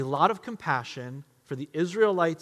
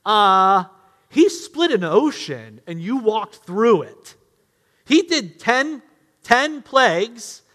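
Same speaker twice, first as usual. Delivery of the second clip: smug lecturing; 5 semitones higher, 9 dB louder than usual. Pretend. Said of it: "Now, we would say to them".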